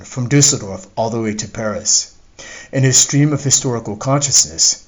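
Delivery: usual speaking voice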